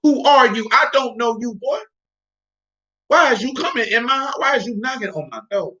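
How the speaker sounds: a hint of an indignant old man; rejecting father.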